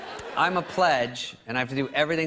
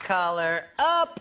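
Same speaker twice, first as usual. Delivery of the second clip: slow smug frat guy; 5 semitones higher, much slower than usual. Retold or imitated. Frat guy pose, collar up.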